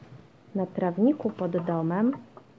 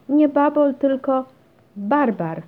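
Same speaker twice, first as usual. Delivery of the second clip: high, loud, playful taunt; giggly teasing; 7 semitones higher, 10 dB louder than usual. A narrator's reading. Basia teases her brother with a silly word, giggly. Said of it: "zachichotała Basia".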